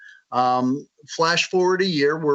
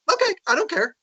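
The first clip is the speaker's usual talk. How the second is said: offhand shrug; 14 semitones higher, faster than usual. Retold or imitated. Co-author voiced shrugging it off.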